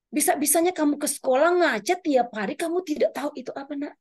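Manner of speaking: high-pitched motherly chiding, retold; mock scolding of a child in disbelief; incredulous chiding.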